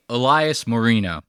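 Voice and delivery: Elias Moreno said the English way, ee-LIE-us muh-REE-no; plain English pronunciation. Shown as a demonstration. Editor correcting host on Elias Moreno, English pronunciation ee-LIE-us muh-REE-no. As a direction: say Elias Moreno Americanized, no rolled r. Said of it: "you just say".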